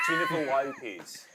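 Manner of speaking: slow, broad Australian vowels; Australian accent. Shown as a demonstration. Coaching co-host with a practice phrase, Australian accent. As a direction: stretch the name in an Australian accent.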